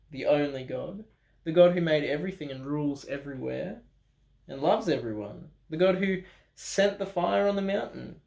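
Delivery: ordinary delivery, nothing marked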